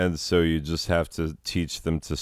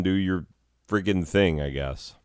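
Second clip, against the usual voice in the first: usual speaking voice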